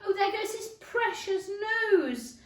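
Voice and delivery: high, loud panicked wail; terrified cry. Acted. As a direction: shriek as the nose is nearly hit. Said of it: "in an agony of terror".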